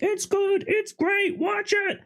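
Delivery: squeaky high hype, mimicking friends; mock hype. Friends urging her to watch, mock gushing high. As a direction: gush it way up high like an overeager fan. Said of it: "everyone being like".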